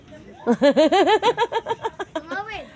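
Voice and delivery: long, high, loud giggle; laughing. Real, not acted. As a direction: burst into a rippling laugh. Laughs with the old woman.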